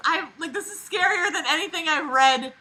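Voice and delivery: ordinary delivery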